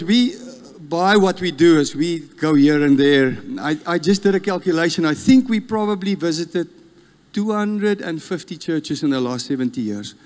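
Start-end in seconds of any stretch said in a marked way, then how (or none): none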